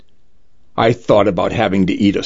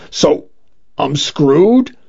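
crying; 5 semitones higher, slower than usual. A narrator's reading. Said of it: "I started to cry".

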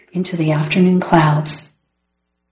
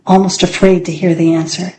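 ordinary delivery, nothing marked

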